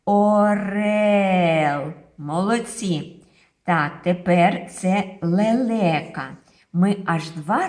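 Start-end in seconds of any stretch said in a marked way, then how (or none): 0.1-1.9 "Орел" said loud and stretched; slow clear teaching word. Shown as a demonstration. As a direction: model the word "Орел" for kids to repeat. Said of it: "повторюйте за мною". (голосно і протяжно «Орел»)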